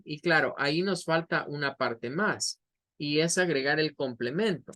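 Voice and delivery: ordinary delivery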